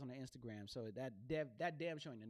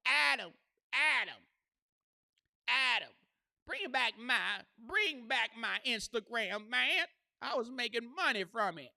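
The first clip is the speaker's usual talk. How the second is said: loud, high pleading call to Adam; yelling a plea across the internet; 8 semitones higher, 13 dB louder than usual. Retold or imitated. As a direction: shout and beg him to bring it back. Calling out to Instagram head.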